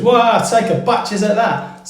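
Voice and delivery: London Cockney voice showing off the slang; Cockney accent. Retold or imitated. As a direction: play it like an East End Cockney pointing something out. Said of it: "This can be used as".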